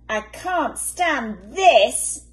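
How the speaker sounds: slow despairing moan; weary complaint. Acted. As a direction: drag every word out, fed up. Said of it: "said Mister Bear".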